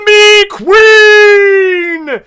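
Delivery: very slow, high, loud drawn-out cry; imitating Sophia's catchphrase. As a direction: belt the catchphrase like a dominatrix queen. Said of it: "Sophia's trademark sayings is".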